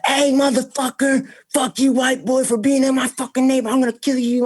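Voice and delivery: high-pitched raging aggressor; mock yell, pitched far up; mimicked angry threat. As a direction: act out a furious street confrontation. Mimics man threatening to kill him.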